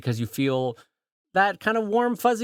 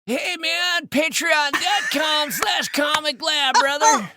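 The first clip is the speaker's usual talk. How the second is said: high, stretched-out California dude accent; Californian accent; 10 semitones higher, much slower than usual. Shown as a demonstration. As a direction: drag out the words like a put-on Californian.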